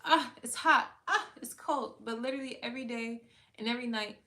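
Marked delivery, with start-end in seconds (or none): 0.0-1.9 loud, whiny mock grumble about the weather; mock complaining. Imitating ungrateful people moaning about the weather, mock whine. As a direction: mimic people griping about the heat and the cold. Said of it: "So they're like".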